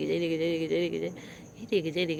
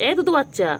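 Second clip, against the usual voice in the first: high tender pet talk; 8 semitones higher, 3 dB quieter than usual. Real, not acted. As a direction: coo over the little one. Baby talk to the cat.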